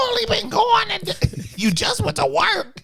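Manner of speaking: high, slow mocking whine; mock whiny partner. Mocking partner missing her.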